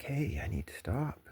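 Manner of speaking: hushed whisper to himself; breathy aside, voice dropped to a whisper; whispered inner voice. Retold or imitated. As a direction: whisper it like a quiet inner warning. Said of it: "and I'm just like".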